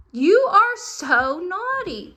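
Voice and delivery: high, loud, drawn-out scolding of a pet; playful scolding. Real, not acted. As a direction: scold the dog, stretching it out high. Scolding the dog, stretched out.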